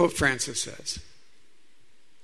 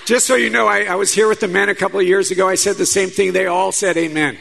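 playful loud teasing; 4 semitones higher, 7 dB louder than usual. Real, not acted. Teasing the silent congregation.

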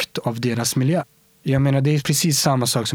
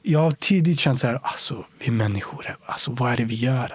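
ordinary talk, nothing marked

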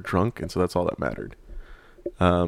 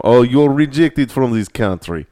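slow, louder mock Russian border guard; Russian accent; 4 semitones higher, 6 dB louder, slower than usual. Jokey border agent in a Russian accent rejecting someone. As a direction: stamp them rejected in a put-on Russian accent. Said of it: "I use that accent quite often".

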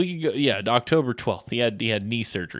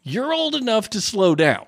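scolding doctor; 10 semitones higher than usual. Retold or imitated. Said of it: "And the doctor's like".

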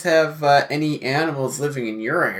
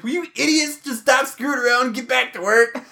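exasperated manager; 9 semitones higher than usual. Retold or imitated. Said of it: "The manager's like".

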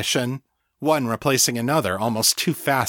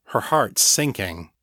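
ordinary delivery

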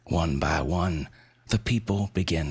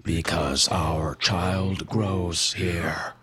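very slow, otherworldly creature voice; eerie Wendigo voice; much slower than usual. Acted. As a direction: drag each word out like something inhuman. Wendigo answers the townsfolk.

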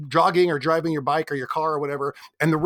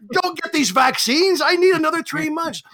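high, loud, slow, hammed-up whine; mock panicked self; 10 semitones higher, 4 dB louder than usual. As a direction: overact the selfish dread of the pandemic ending. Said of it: "I'm like".

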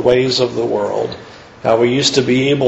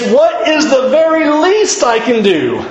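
mock self-serving question; 10 semitones higher than usual. Mocking a self-serving believer's question.

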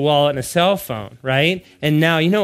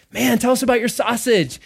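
higher, enthusiastic retold question; lightly acted keen curiosity, pitched up; eager; 9 semitones higher than usual. Father and son ask the charcuterist, eager.

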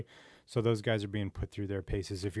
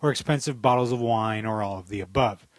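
a dry, wry reading of a written rant; read-aloud op-ed; 6 dB louder than usual. Acted. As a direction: deliver the essay like a sarcastic column. Reads a written satirical essay.

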